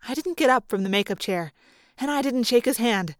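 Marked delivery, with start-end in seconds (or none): none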